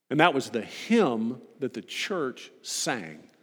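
usual speaking voice

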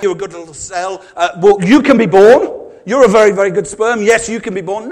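hammed-up praise of a good little cell; mock approving voice. Preacher voicing a cell's approval, mock praise.

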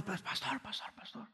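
frightened whisper, barely any voice; a scared brother whispering a warning to the pastor. Retold or imitated. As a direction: hiss out the warning, scared stiff. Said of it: "entra bien asustado".

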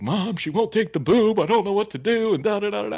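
hammed-up tearful new mom on the phone; imitating crying. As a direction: wail it in a put-on high voice. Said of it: "she's crying".

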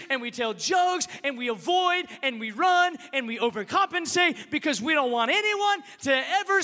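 loud, high, urgent sermon; full-force preaching. Real, not acted. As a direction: build a driving list at full voice.

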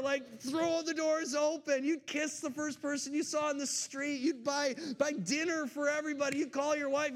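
normal talking voice

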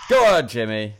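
loud encouraging call; cheering on. Real, not acted. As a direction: cheer the player on. Urging the player on.

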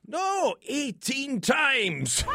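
high, slow Scottish yell; bellowing Scotsman, outraged; Scottish accent shout. Retold or imitated. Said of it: "in a Scottish accent".

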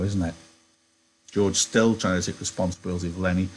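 ordinary delivery

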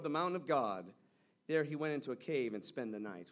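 measured, ringing Bible reading; scripture reading. Acted. Pastor reading scripture to the church, measured and ringing. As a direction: read the passage aloud to the congregation. Reads scripture aloud to congregation.

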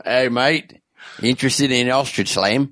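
a lightly put-on Australian accent, slow; a hint of Australian accent, badly done. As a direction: hawk ostrich slam in a weak Australian accent. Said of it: "Australian accent's never been one of my strong suits".

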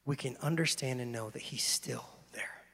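soft unvoiced preaching; whispered reassurance. Preacher assuring the congregation God is still there, whispering. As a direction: breathe it out as an intimate promise.

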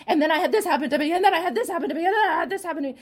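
fast, loud and high, laughing through it; hammed-up frantic oversharing; manic retelling. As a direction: rush it out like you can't stop. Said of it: "manic and crazy".

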